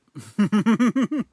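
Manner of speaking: high, loud cackle; loud laughter. Real, not acted. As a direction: burst out laughing. Reacting to chewing sounds.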